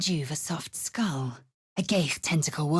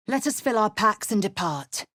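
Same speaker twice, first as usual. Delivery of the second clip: normal talking voice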